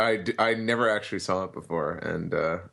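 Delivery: normal talking voice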